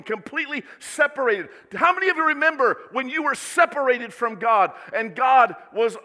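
loud, high, fervent preaching; rising sermon fervor. Acted. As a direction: preach it out with full force. Preaching at full force.

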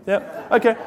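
ordinary talk, nothing marked

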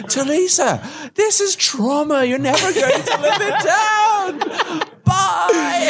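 high, slow theatrical warning; mock dramatic warning. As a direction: ham it up like a prophet of doom. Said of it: "You're gonna wake up screaming".

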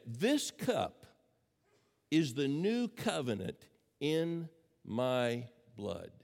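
slow, measured quotation with weighty pauses; solemn quotation. Acted. Preacher quoting Jesus at the Last Supper, slow and solemn. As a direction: let each closing word stand alone, slowly.

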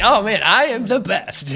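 high, loud, slow mock boasting; hammed-up gloating, drawn out; smug self-congratulation. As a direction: play a cocky student basking in praise. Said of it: "gets this little smug look on his face".

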